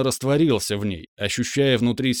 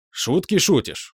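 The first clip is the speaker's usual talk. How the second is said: slow, angry snap; angry bark; 6 semitones higher, slower than usual. A narrator's reading. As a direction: bite the words out angrily. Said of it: "зло рявкнул". (зло рявкнуть)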